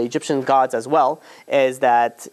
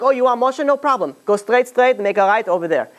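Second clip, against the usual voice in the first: put-on much higher, playful children's voice; mischievous kids; 7 semitones higher than usual. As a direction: tease him with fake directions. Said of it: "they're like".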